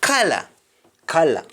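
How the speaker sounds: "Color" said kala, with an open "ah"; English-style "color", first vowel "ah"; English pronunciation. Shown as a demonstration. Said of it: "Pronounced in English".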